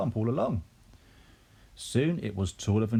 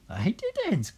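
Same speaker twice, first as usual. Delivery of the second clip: high put-on granny cackle; cackling old woman; 13 semitones higher than usual. A narrator's reading. Old woman answering Mary, high cackling voice. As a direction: cackle the words, pitched high. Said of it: "cackled the old woman".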